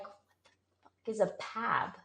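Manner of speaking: a quiet, stunned what-the; baffled mutter. Retold or imitated. Baffled reaction to note.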